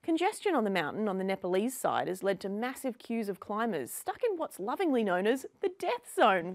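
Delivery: ordinary delivery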